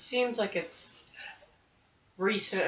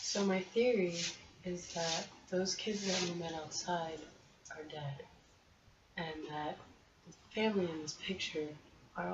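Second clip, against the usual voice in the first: hushed, lowered character voice with long pauses; 3 semitones lower, 11 dB quieter than usual. Acted. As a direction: say it softly and haltingly, in character. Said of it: "Tell us in your character voice".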